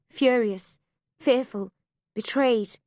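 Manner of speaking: plain reading voice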